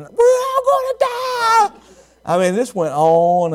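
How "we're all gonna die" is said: high, drawn-out wailing shriek; mock-terrified girl's scream; imitated scream. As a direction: wail it slowly in panic. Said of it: "then here she went again".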